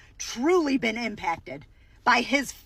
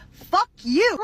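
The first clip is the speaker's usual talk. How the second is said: sudden loud curse, higher; angry outburst; 7 semitones higher, 6 dB louder than usual. Real, not acted. Comedian cursing at the cheese lady, loud outburst. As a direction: snap it out at the screen. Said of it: "angry outburst at the voter".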